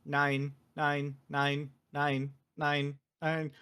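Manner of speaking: low, level automated announcement; monotone announcer stuck on a repeated word. Retold or imitated. Game's announcer greets a waking test subject, monotone announcer voice. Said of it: "the same voice says".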